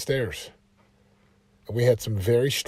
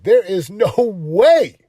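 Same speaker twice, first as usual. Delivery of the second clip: emphatic refusal; 7 semitones higher, 10 dB louder, much slower than usual. Retold or imitated. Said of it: "I will be like".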